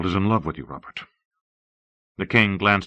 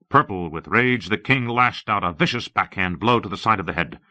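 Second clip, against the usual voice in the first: usual speaking voice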